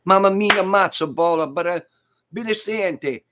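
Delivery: high, loud fake Italian outburst; mock Italian. Comic defies Italian critics in chat, mock Italian accent. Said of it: "I'm not speaking real Italian".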